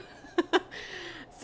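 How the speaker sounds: quick uneasy giggle; nervous laugh. Real, not acted. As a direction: laugh off the nerves. Nervous about playing on.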